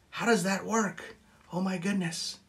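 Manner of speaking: mock wonder. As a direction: marvel at it like a showman. Mock amazement at own trick.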